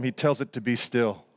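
ordinary delivery

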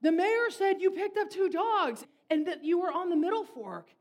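the owner running up to the truck, excited and loud; breathless man. Retold or imitated. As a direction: blurt it out after running up. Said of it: "he's like".

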